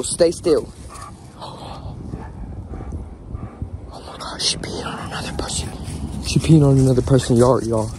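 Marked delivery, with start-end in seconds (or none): 1.4-5.7 shocked whisper; hushed whisper. Real, not acted. Owner telling viewers about the dog peeing, whispered. 6.3-7.9 loud, low-pitched report; loud announcement. Real, not acted. Owner repeating the dog news to viewers, loud and low.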